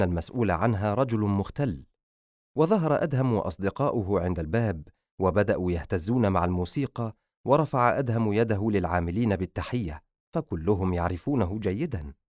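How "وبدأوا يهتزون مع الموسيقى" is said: ordinary delivery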